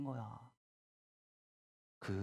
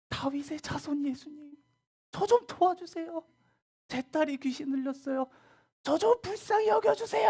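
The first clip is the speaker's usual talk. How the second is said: high, loud, desperate begging; frantic mother shouting high and loud over the crowd; shouting plea; 6 dB louder than usual. Acted. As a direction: keep yelling for mercy, more urgent each time. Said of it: "계속 소리 지르는 거지".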